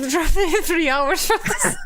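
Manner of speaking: high, loud words through laughter; real laughter bursting through the words; laughing. Real, not acted. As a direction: crack up while saying it. Laughing at the game.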